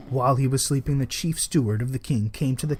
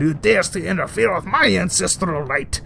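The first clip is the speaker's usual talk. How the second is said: mild monster scream; 5 semitones higher than usual. A narrator's reading. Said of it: "screamed Catelyn".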